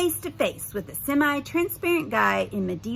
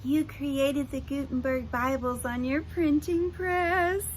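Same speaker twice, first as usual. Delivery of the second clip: gushing; 5 semitones higher than usual. Acted. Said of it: "gushed Sierra".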